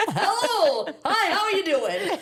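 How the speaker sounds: high beaming hello; forced cheery greeting. Shown as a demonstration. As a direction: grin through a cheerful hello. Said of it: "I basically walk the streets like this".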